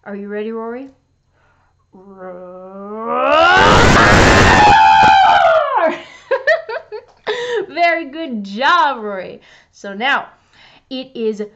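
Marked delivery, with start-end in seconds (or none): 1.9-3.7 getting louder. Acted. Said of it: "make your own crescendo with your roaring sounds". 3.8-7.0 deep shouted roar. Acted. Lion roar crescendo.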